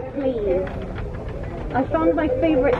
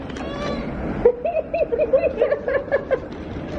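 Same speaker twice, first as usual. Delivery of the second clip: high-pitched giggling fit; high laughing; 13 semitones higher than usual. Real, not acted.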